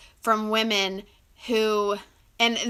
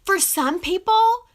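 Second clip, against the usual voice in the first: ordinary talk, nothing marked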